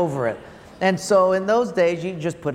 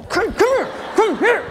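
much higher, louder beckoning mom; mock mom calling; 14 semitones higher, 4 dB louder than usual. Preacher imitating his mother beckoning the kids to be smacked, much higher and louder.

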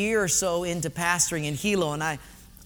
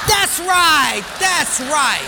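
high, triumphant shout easing off; acted quizmaster's delight; loud approval; 7 semitones higher, 8 dB louder, much slower than usual. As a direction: cheer the answer on loudly. Quizmaster cheers answer.

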